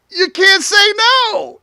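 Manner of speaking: high, loud and gleeful; triumphant you-can't-say-no; gleeful punchline. Real, not acted. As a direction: land the gotcha line with glee. Punchline of sales trick.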